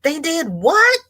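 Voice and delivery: high, loud mock disbelief; shocked reaction. Host replaying her reaction to bizarre news, mock shock. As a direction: squeal it in exaggerated astonishment. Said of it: "I'm sitting here going".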